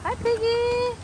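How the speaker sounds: cutesy greeting. Acted. Greeting a pig for child.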